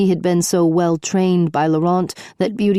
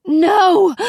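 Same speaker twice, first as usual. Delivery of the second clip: high, loud shriek of refusal; 12 semitones higher, 5 dB louder than usual. A narrator's reading. Princess shrieking at the captain's news, high and loud. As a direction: shriek it out, desperate. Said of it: "Beauty shrieked".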